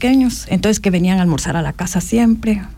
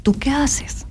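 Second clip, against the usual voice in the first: whispered question; hushed retold question; soft, breathless asking; 4 semitones higher, 4 dB quieter, much slower than usual.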